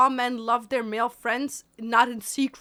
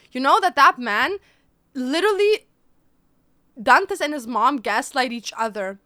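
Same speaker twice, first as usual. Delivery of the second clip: loud; 8 dB louder than usual. Real, not acted. Excited rant.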